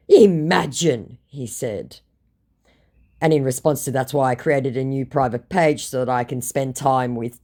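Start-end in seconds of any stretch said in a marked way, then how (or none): 0.1-1.2 slow, loud, drawn-out mock retort; mock scammer retort. Scammer retorts to the doubter, drawn-out mock emphasis. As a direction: stretch it out with theatrical emphasis.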